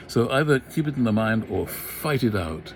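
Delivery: usual speaking voice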